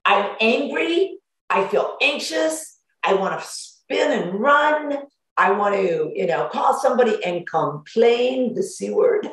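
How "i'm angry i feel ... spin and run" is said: higher, slow, whiny complaint; upset venting person. Acted. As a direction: play someone overwhelmed and miserable. Acting out feeling terrible.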